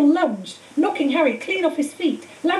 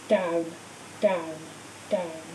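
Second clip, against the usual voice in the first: fading away; 7 semitones lower, 7 dB quieter, much slower than usual. A narrator's reading. Fading into blackness.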